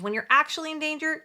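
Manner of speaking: usual speaking voice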